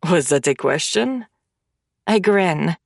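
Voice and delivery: ordinary delivery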